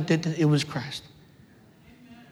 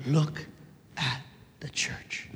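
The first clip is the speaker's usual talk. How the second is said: very low, fading to a whisper; slow, solemn hush; hushed pronouncement; 7 semitones lower, 13 dB quieter, slower than usual. Acted. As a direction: let each word drop away almost to breath. Voices what the Spirit will say.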